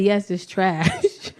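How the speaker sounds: low, slow, gentle explanation; grandma gently teaching a baby word; patient grandma. Retold or imitated. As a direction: spell it out tenderly for a toddler. Grandma answering toddler slowly.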